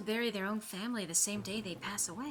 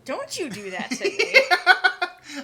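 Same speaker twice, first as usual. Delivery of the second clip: laughing protest; 10 semitones higher, 13 dB louder, much slower than usual. Real, not acted. Laughing protest at co-host's joke.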